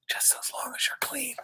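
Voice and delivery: put-on whispered aside to the kids. Reader whispering a tip to listening children. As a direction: drop to a hushed whisper.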